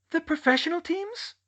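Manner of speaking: very high incredulous question; alarmed, put-on high voice; startled Dolores. A narrator's reading. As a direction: echo it back in high-pitched disbelief.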